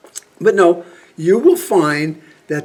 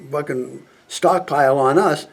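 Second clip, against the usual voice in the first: ordinary delivery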